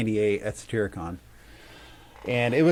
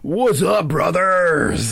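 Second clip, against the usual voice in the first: pitched-up, louder wrestling-promo growl of a greeting; Macho Man Savage; 7 semitones higher, 5 dB louder, much slower than usual. Retold or imitated. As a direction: hype it up like a pro wrestler cutting a promo. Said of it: "almost like a Macho Man Savage".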